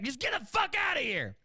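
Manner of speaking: high-pitched imitated angry yell; flipping out. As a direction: yell it like the enraged clerk. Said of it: "just flips out".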